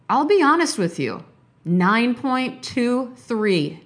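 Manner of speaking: teasing slow big reveal; dramatic reveal. Real, not acted. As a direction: stretch it out for suspense. Dramatic reveal of mood score.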